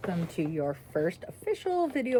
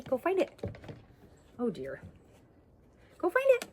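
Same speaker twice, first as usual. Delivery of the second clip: firm dog commands; 4 semitones lower, 4 dB quieter than usual. Real, not acted. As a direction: send the dog off cheerfully. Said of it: "I'm doing a go find it".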